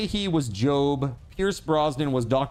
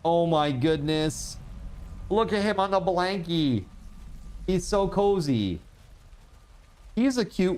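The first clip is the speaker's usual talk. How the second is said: talking to a cute kitty picture, cooing; cooing over a kitty; 6 semitones higher than usual. Real, not acted. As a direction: melt over the photo of a cozy cat. Cooing over a cat photo.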